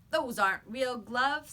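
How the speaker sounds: put-on a bully scoffing at the kid; bully retort. A narrator's reading. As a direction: brush him off like a bully.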